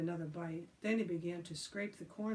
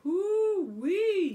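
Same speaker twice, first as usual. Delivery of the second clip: very high, loud, drawn-out whoop; whooping yell; 12 semitones higher, 12 dB louder than usual. A narrator's reading. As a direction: whoop as the chase begins. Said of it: "yelled Billy".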